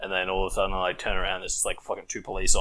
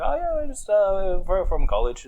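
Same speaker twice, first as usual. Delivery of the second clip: high student voice; 11 semitones higher than usual. Retold or imitated. Students answer cops, high voice.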